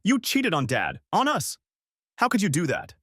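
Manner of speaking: put-on a hurt child's cry of betrayal; voice cracking with emotion. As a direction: son confronting his mother, voice cracking with anguish. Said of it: "His voice cracked with emotion".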